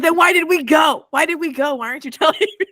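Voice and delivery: exasperated, high-pitched mock complaint; irritated outburst. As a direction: demand an answer in high, half-laughing frustration. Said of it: "It's so irritating".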